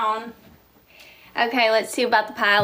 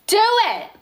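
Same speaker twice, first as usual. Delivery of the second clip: emphatic urging; 8 semitones higher than usual. Real, not acted. Host urging viewers toward dream job, emphatic. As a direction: DO IT pitched high and insistent. Repeats the urging with more force.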